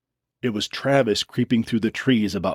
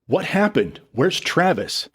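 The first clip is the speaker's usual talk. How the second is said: higher urgent questions; alarmed; 8 semitones higher than usual. A narrator's reading. Sarah alarmed as Dylan storms in. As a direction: ask in sudden alarm.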